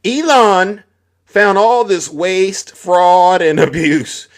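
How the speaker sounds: sarcastic sing-song about Elon; mocking. Mocking the Doge promise.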